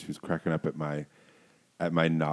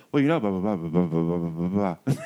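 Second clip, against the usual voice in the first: mock rambling; 5 dB louder than usual. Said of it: "I was just like".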